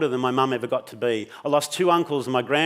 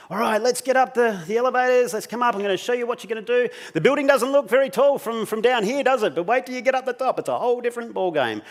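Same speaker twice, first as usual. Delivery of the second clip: high, lively, put-on cheer; upbeat instructor patter; 9 semitones higher than usual. Instructor leading nervous guests to the elevators, put-on cheer. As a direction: herd the group along in a high, peppy voice.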